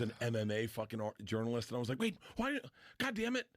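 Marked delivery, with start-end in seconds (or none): 2.0-3.5 acted-out high, pained protest; high-pitched exasperation. Comic retelling his reaction to the journalist, high exasperated protest. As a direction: protest in a much higher voice.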